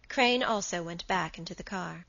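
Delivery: ordinary delivery, nothing marked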